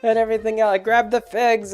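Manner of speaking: high, frantic pixie chatter; retelling frantic tiny pixies fleeing the party, high and panicked; panicky pixies. Retold or imitated. Panicking pixies grab their things.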